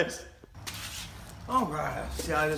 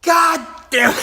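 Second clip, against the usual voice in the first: high, loud retold curse; hammed-up shocked outburst; shocked dad; 11 semitones higher, 5 dB louder than usual. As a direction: imitate a stunned man cursing. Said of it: "he's all shocked".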